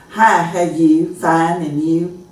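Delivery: slow, drawn-out nasal family greeting; kinda nasally. Shown as a demonstration. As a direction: imitate a nasal hometown drawl. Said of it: "kinda nasally".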